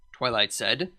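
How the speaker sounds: ordinary delivery, nothing marked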